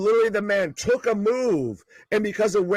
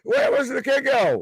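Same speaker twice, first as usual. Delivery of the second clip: excited; 7 semitones higher than usual. Real, not acted. Near fall reaction.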